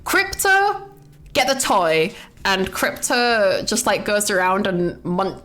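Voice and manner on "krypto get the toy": high call, then a command; calling the dog. Retold or imitated. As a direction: imitate a hero sending his dog off. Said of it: "No, he says".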